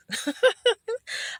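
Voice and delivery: a high, bright burst of laughter; real high giggle. Real, not acted. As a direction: laugh it off at yourself. Laughing about her three moods.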